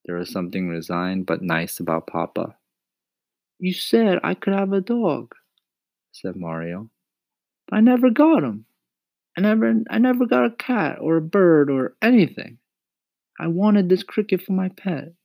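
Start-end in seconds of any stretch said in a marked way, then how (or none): 3.6-5.4 put-on high-pitched, excited boyish plea; pleading boy Mario. A narrator's reading. Mario begs Mama to let the cricket stay, pleading. 7.7-15.1 put-on high-pitched, excited boyish plea; pleading boy Mario. A narrator's reading. Mario begs Mama to let the cricket stay, pleading.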